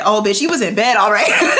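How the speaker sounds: loud teasing, laugh creeping in; laughing mockery. Real, not acted. Host mocking Kristen's alibi, laughing tease. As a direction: jeer at the excuse, starting to laugh.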